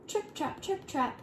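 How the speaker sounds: high, soft little hoof taps; light trip-trap. A narrator's reading. Smallest goat crosses the bridge, light high trip-trap.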